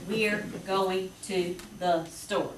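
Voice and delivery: "We're going to the store" slowly, each word separate; halting reading, every word cut apart; choppy word-by-word phrasing. Shown as a demonstration. Teacher showing parents unphrased reading, slow and word by word. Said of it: "You don't say".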